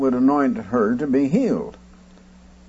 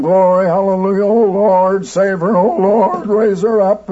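higher, louder imitation of a fervent congregation crying to God; retold frantic crying out; 5 semitones higher, 4 dB louder than usual. Preacher mimics the congregation wailing to God, higher and louder. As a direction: echo their frantic pleading, not full scream. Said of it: "they were screaming out to God".

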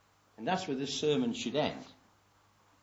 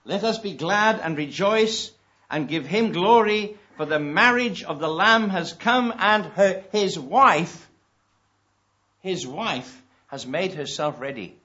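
louder, higher, slow proclamation; preacher ringing out the wedding verse; full-force preaching; 4 semitones higher, 11 dB louder, slower than usual. Acted. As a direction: build it to a joyful climax. Preacher climaxes with scripture.

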